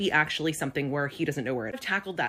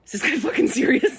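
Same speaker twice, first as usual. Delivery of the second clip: higher, louder outraged aside; exasperated disbelief; 8 semitones higher, 7 dB louder than usual. Retold or imitated. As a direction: blow up in disbelief. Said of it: "And I'm like".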